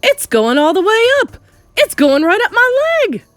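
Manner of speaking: high, loud rising wail; comic hysterical alarm; panicked yelling. A narrator's reading. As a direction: yell as if something is crawling on you. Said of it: "the man was yelling".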